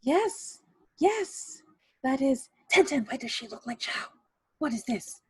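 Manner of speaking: high, startled aunt demanding answers; aunt thrown off balance, voice jumping up; aunt asking Tenten what is going on, high and shocked. Acted. Aunt shocked by lookalike bride.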